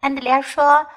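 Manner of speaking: usual speaking voice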